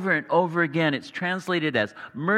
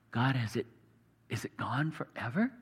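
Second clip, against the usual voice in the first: softer, lower voice of doubt; subdued pleading question; 6 semitones lower, 7 dB quieter than usual. Acted. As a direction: ask God quietly, almost to yourself. Voicing the psalmist's despairing question.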